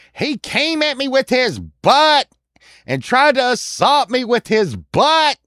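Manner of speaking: high, loud, drawn-out complaint; hammed-up scandalized lady; outraged lady. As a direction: wail the accusation like an affronted woman. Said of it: "This lady was like".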